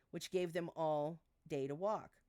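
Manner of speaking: plain reading voice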